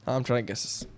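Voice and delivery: hushed, near-whispered aside; thinking aloud quietly. Real, not acted. Co-host guessing the lineup, thinking aloud quietly. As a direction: think it over under your breath.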